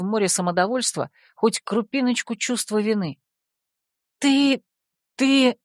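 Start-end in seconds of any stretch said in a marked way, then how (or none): 4.2-5.6 high, halting outrage; choked with indignation. A narrator's reading. Heroine facing the shameless informer, indignant. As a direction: stammer it out, lost for words. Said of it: "Задыхаясь от возмущения".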